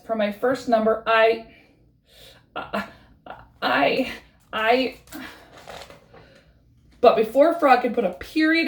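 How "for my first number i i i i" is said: stalling, frightened pauses; slow and halting. Acted. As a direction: freeze up on stage. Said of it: "Slowly he says".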